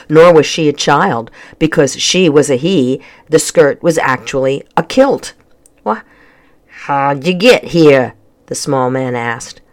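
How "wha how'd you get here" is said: slow, grumbling Scottish-style brogue; gruff man with a thick brogue; thick accent. A narrator's reading. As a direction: demand it slowly in a thick Scottish burr. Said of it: "his accent was thick and tricky to understand".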